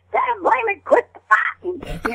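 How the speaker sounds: high, slowed-down holler to stop a bar fight; Ma Kettle voice. Retold or imitated. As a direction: break up a fight in a rough mountain-woman voice. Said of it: "I use that voice in all the Skull Orchards".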